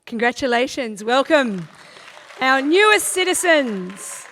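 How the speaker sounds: loud, rising ceremonial welcome; celebratory announcement. Real, not acted. Officiant welcoming our newest citizens, loud and celebratory. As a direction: hail them over the applause.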